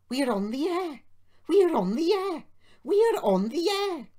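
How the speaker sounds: high, loud excited squeal, rising in force; shrill little engine cheering; excited puffing. A narrator's reading. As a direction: puff it out in triumphant high bursts. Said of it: "puffed Peter Sam".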